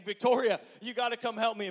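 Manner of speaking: loud, high mock cry for wife; husband's mock panicked yell to wife; yelling for help. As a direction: call down for help, hammed-up.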